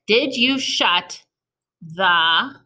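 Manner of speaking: spaced out, rising up on door; slow dictation. Shown as a demonstration. Teacher writing the sentence out slowly for the class. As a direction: draw the words out as you write. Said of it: "what would you put at the end".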